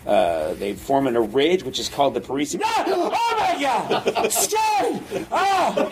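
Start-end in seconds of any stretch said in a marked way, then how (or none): 2.6-5.9 high, slow hammed-up shrieks of fright; scared shriek. Professor startled by the skeleton again, hammed-up high shrieks. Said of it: "he gets just as scared each time".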